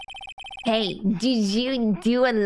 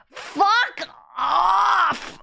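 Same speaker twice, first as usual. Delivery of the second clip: disgusted outcry; 8 semitones higher than usual. Real, not acted. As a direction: recoil in disgust. Disgusted by tongue.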